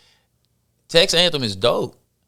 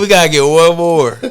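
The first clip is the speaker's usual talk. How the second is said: loud, higher, laughing; laughing excitement; 5 semitones higher, 8 dB louder than usual. Real, not acted. Pushing friends for one more halftime act, laughing excitedly. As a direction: call it out through laughter.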